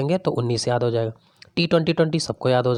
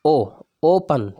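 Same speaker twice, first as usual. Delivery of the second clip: very slow, stretching "ओ" and "ओपन" apart; drawn-out demo; much slower than usual. Shown as a demonstration. Teacher to students, slowly drawing out "ओपन". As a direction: spell out "ओपन" syllable by syllable, slowly. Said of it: "तो पन में क्या हो रहा है".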